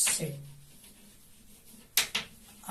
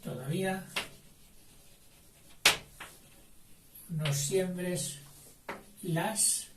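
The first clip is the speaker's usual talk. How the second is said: slow dictation; 4 dB quieter, slower than usual. Shown as a demonstration. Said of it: "dictation word by word".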